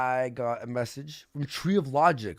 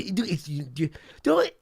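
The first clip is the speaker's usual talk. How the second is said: ordinary talk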